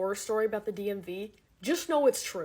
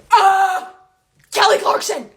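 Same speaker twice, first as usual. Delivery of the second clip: loud mock shout; 10 semitones higher, 11 dB louder, slower than usual. Mocking rude customers shouting.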